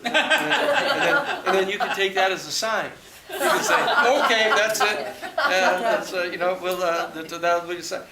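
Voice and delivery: high, loud laughing through the words; can't stop laughing while joking. Real, not acted. Laughing as he jokes to the host about being killed first.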